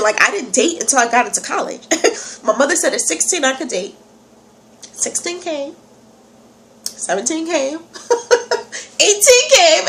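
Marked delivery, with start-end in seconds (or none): 4.9-9.9 building comic anticipation. Retold or imitated. Recalling birthdays passing while waiting to date, building from hushed to excited.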